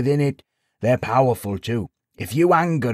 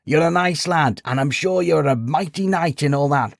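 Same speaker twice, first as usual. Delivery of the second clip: higher, building from placating to incredulous; amused disbelief; 9 semitones higher, 4 dB louder than usual. A narrator's reading. Sage explains the knight's folly, increasingly incredulous. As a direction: build up to a scoffing punchline.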